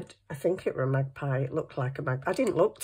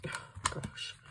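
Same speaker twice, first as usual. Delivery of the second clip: whispered "oh gosh" to herself; quiet muttered aside; muttered under breath; 12 dB quieter than usual. Real, not acted. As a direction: breathe it out while hunting. Muttering while searching desk.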